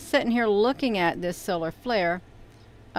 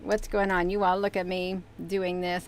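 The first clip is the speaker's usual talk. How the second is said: ordinary talk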